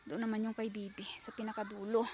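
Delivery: soft unvoiced whisper. Real, not acted. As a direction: whisper it as an aside. Pointing out a house quietly.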